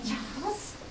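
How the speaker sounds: faint, hesitant rising Charles?; soft questioning call. Acted. Mother nudging her son to speak, hushed. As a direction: ask Charles? gently, barely voiced.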